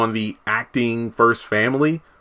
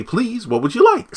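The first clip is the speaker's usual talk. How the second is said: mock prim correction; 11 semitones higher than usual. [name]'s voiced polite correction.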